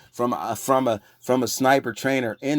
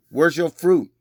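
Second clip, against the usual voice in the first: raised challenge; 6 semitones higher, 6 dB louder, slower than usual. Real, not acted.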